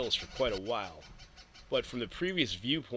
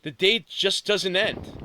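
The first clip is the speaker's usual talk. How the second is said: higher, louder and slow, dragging it out; loud weary lament; 7 semitones higher, 7 dB louder, slower than usual. Acted. Exhausted girl complaining to the empty hills, loud and slow. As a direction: wail it slowly, fed up. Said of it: "she cried out loud".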